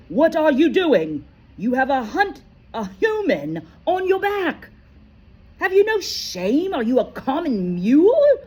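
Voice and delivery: loud, high, angry scolding; outraged shout. A narrator's reading. Bane scolding Firenze for carrying a human, loud and high. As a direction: berate him like a disgraced herd-mate. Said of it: "Bane thundered".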